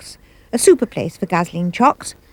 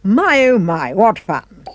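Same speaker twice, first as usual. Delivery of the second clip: ordinary delivery, nothing marked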